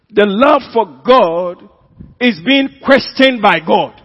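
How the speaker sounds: loud, high, declamatory pulpit delivery; full-force preaching. Real, not acted. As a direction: proclaim each phrase with force, stretching key words.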